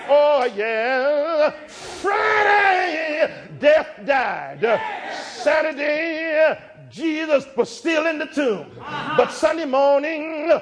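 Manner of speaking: rising sermon cadence, hymn lines shouted; fervent pulpit delivery; preaching at full force. Acted. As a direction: preach it, building to a shout on how great thou art. Climactic sermon close, whooping.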